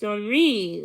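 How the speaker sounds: Henri said the French way, ahn-REE, stress on the last syllable; French pronunciation. Shown as a demonstration. Lizzie correcting Charles, Henri said ahn-REE. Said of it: "with the accent on the second syllable".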